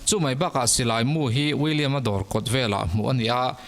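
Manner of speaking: ordinary talk, nothing marked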